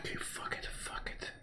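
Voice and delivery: breathy whispered swearing; whispered curse. Real, not acted. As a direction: whisper it under your breath. Giving up, muttering to self.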